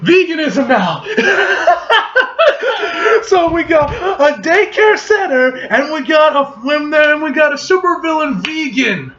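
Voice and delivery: shouting through laughter; cracking up mid-rant; laughing outburst. Real, not acted. Laughing outburst at vegan villain.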